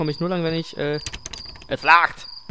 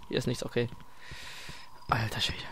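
voiceless awed whisper; 6 semitones lower, 13 dB quieter than usual. Real, not acted. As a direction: breathe it out in awe.

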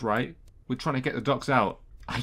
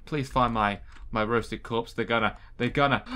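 usual speaking voice

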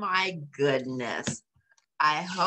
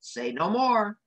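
a touch of gallant pride; bow and offer help; gallant Scottish terrier; 6 semitones higher than usual. A narrator's reading. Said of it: "Jock gallantly told her".

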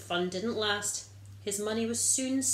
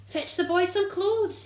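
higher, louder happy father calling out; joyful shout; 10 semitones higher, 7 dB louder than usual. Acted. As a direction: call across the yard to the servants. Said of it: "he shouted".

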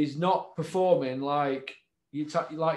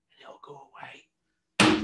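whispered aside; slower than usual. Real, not acted. To John, promising the row will end, whispered slowly. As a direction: drop to a slow whisper. Softly telling John to back down.

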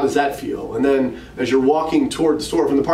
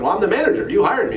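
higher-pitched, lightly cheeky comeback; smart aleck; 7 semitones higher, faster than usual. Retold or imitated. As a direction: answer your boss like a bit of a smart aleck. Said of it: "I was sort of being a smart aleck".

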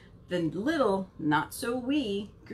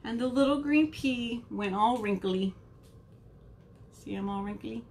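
ordinary delivery

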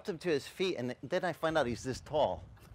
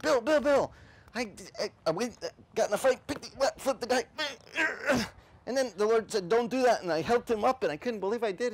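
high, louder breathless rush; excited telling; 10 semitones higher, 3 dB louder than usual. Retold or imitated. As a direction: burst out like a thrilled kid. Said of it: "told our assistant pastor".

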